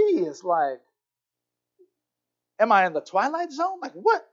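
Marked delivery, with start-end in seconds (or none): 2.6-4.3 high-pitched, stunned questioning; shocked disbelief. Retold or imitated. Woman baffled by stranger eating her cookies, shocked. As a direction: act out her bewildered double take. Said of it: "and then she's shocked".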